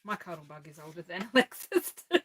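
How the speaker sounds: laughing through the line. Real, not acted. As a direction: laugh as you repeat it.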